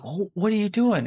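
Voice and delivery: mock a child's startled protest; shocked kid. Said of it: "And the kid's like".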